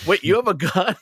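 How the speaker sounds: high, loud startled question; the character can't believe it; shocked. Retold or imitated. Conductor character learns passenger has a gun, shocked. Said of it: "my character was shocked".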